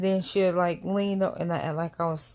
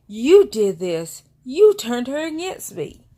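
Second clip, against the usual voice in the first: loud, high retold accusation; mad sister; 5 semitones higher, 10 dB louder than usual. Sister blaming her over the baby, mock angry. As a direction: imitate an angry sister laying blame. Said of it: "my sister was mad".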